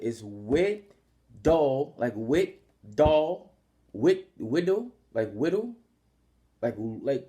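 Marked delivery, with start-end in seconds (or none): 0.0-5.7 Whittle stretched very slowly, rising; drawn-out name demo. Shown as a demonstration. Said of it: "that's how you pronounce it".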